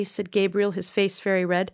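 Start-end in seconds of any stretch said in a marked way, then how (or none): none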